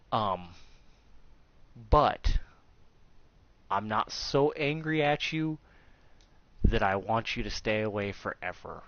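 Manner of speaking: normal talking voice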